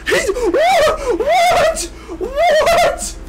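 shocked laughing shout. Real, not acted. Shocked by an opponent surviving.

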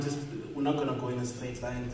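ordinary talk, nothing marked

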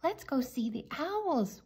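ordinary delivery, nothing marked

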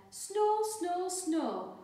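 high, loud mock snore; snoring noise. A narrator's reading. Missus Bear snoring in bed, high mock snore. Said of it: "went Missus Bear".

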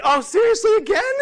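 very high, loud disbelieving whine; exasperated shriek. Pretend. Team seeing the numbers drop again, a shrill exasperated whine. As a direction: squeal in mock despair. Acted team despairing at repeated failure.

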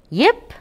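high, sharp imitated puppy yip; a tiny dog yipping from the grass. Narrator voicing a hidden puppy, high yip.

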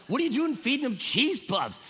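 high-pitched incredulous scolding; mock outrage. Grandfather questioning the kids' baby snacks, mock outrage. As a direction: exclaim it, aghast, pitch jumping up. Retells shocked reaction to cheese puffs.

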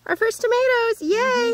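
high-pitched gleeful cheer; excited celebration. Real, not acted. Mom celebrating their first tomatoes, excited and high-pitched.

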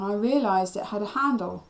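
plain reading voice

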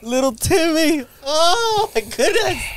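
very high, slow tearful wail; hammed-up weeping, stretching the words; mock bawling. Mourning little Timmy from the film, mock bawling. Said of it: "I bawled my eyes out".

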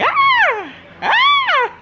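put-on very high, loud baby squeal "Ah! Ah!"; imitated baby squeal. Mom imitates baby Ava squealing on the phone. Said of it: "Ava was like".

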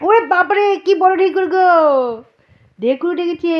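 high, loud delighted exclamation; amazed. Real, not acted. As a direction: marvel at the huge burp. Baby's big burp.

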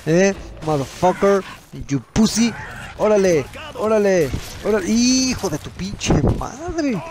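high triumphant taunt; gloating trash talk. Real, not acted. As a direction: rub it in his face. Trash talk after kills.

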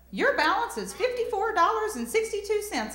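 very high-pitched bank operator; bank phone lady. Acted. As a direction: read out a balance in a high operator voice. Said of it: "the lady's like".

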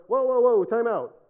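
loud, high mock alarm; hammed-up urgent interruption; urgent protest. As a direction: call a halt like a startled joke character. Bear halts hunter in joke.

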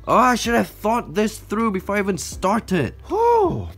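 high-pitched, stressed lament; regretful wail. Real, not acted. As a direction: wail with regret, rising to a squeal. Regrets starting the stressful rehousing.

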